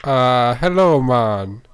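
a laid-back Jamaican hello, man; mock Jamaican accent. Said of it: "the other one has a Jamaican accent".

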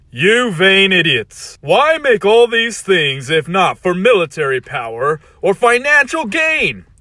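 loud, slow scornful villain; evil villain rant. Acted. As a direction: lecture them like a contemptuous tyrant.

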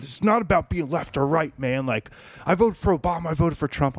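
retold rant. Bro insists he is not left or right, mock ranting. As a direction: imitate a defensive political tirade. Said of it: "goes into this whole fucking rant".

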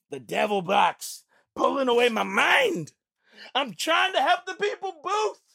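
mock grumble at the phone in a silly voice; silly put-on voice. Putting his phone away, a lightly goofy put-on voice.

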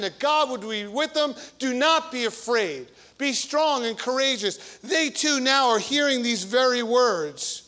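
fervent pulpit preaching. Acted. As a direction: proclaim the scripture to the congregation. Preaching at full force.

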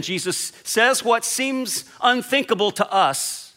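usual speaking voice

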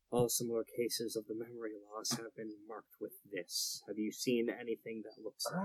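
soft, low, far-off memory voice; like a memory echoing back; distant flashback echo. Acted. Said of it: "the perfect memory voice".

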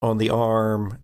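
ordinary talk, nothing marked

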